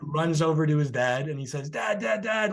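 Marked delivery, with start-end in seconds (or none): 1.7-2.5 higher-pitched, urgent mock kid call; retold excited call to Dad. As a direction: imitate a boy eagerly getting his father's attention. Excited boy calling his father.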